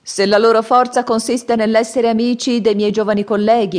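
high, loud and courteous; bow and greet the old man sweetly; polite little monk. Acted. Monkey scoffs. (monacello cortese che saluta il vecchio, voce alta)

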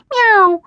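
high, loud kitten meow; a startled little kitten cry; squeaky, surprised meow. A narrator's reading. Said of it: "he said in surprise".

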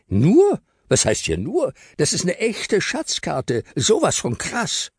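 put-on a kid who won't let his find be belittled; indignant boy Abdul. Abdul answers Joshua, indignant and high.

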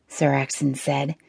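plain reading voice

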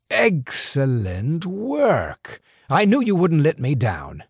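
put-on a sly older fixer, amused and in control; smooth crime boss. Crime boss lecturing two young couriers, sly and amused. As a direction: toy with the boys like a patient boss.

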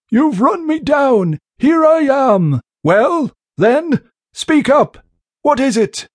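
high, slow, tense sea captain; a cornered old seaman forcing it out; strained old captain. Acted.

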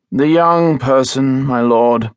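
weary, slow butler announcement; drawn out, tired and bored. A narrator's reading. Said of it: "the butler said wearily".

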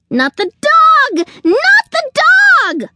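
very high, loud, drawn-out wail; escalating panicked yell. A narrator's reading. Squirrel begging Sugar not to fetch the dog, yelling. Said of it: "yelled the squirrel".

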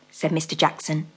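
usual speaking voice

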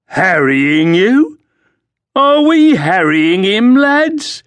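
high, slow, mocking soldier; sneering guard. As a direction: taunt him like a street thug. Guard mocks Crispin to friends.

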